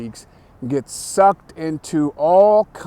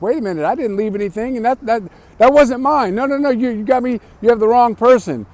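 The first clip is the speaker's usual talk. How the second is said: higher, louder, rushed pleading; panicked denial; 7 semitones higher, 5 dB louder, much faster than usual. Retold or imitated. Prank victim protesting to the fake caller, panicked and fast. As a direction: beg fast, voice climbing in panic. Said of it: "the person on the other phone is like".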